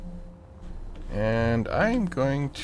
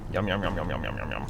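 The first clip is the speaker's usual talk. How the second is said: rapid run of yums, dropping away; playful munching; 4 semitones lower, 5 dB quieter, faster than usual. Pretend. Gamer taking a bite out of a rock, playful munching. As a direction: pretend to gobble it, yum after yum.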